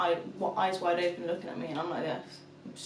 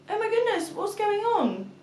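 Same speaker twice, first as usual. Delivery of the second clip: startled mum, re-enacted; 12 semitones higher, 5 dB louder than usual. Mum woken by her daughter, startled and high. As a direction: act out being jolted awake.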